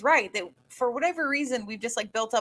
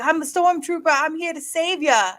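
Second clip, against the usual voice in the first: put-on a light South London accent, slowing at the end; 6 semitones higher, 4 dB louder than usual. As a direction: play the stormtrooper in a London accent. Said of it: "one of the first things he tried".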